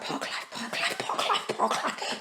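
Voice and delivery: soft unvoiced chant; whispered to hold back tears. Fighting tears on camera, she whispers a calming chant. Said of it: "trying to keep the tears in".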